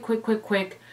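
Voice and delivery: ordinary talk, nothing marked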